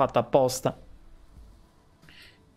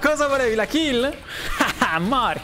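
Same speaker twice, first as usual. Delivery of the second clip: explosive italian cursing; 8 semitones higher, slower than usual. Real, not acted. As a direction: yell the insults, laughing at the kill. Cursing at enemy after kill.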